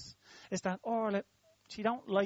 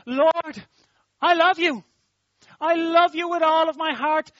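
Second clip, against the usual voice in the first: rising, louder and higher declaration of love to the Lord; fervent prayer; 4 semitones higher, 6 dB louder than usual. Acted. Preacher voicing a believer's prayer to the Lord, fervent and rising to a shout. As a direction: cry it out to God, building to a shout. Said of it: "You say".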